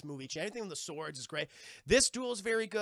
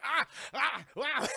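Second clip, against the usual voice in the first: short high, loud comic cries; mock panicked yelps; 7 semitones higher, 5 dB louder, slower than usual. Streamer imitates Jack fleeing his pursuers, comic yelps. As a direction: yelp like a cartoon runaway.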